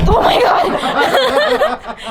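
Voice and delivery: laughing through the words. Real, not acted. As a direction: giggle it out. Laughing at Curtis.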